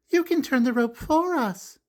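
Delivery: put-on high kid's voice, a bit bossy; high playground girl's voice; bossy little girl. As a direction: tell him what to do like a playground boss. Jill voiced in picture book.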